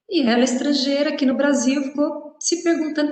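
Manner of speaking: normal talking voice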